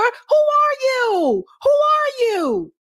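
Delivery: high, drawn-out incredulous question; incredulous demand. Real, not acted. Host challenging politician's fake accent, high incredulous demand. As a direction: stretch it out high in disbelief.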